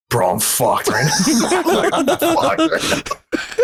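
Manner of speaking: drawn-out words climbing into high laughter; laughing self-talk. Retold or imitated. Re-enacting talking to self while recording, laughing helplessly. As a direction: slowly dissolve into squeaky laughing.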